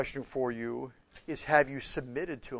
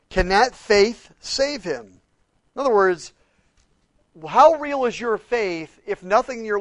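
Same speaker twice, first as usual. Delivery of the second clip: loud, raised preacher's voice; full-force preaching; 6 semitones higher, 10 dB louder than usual. Acted. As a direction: drive the point home to the congregation.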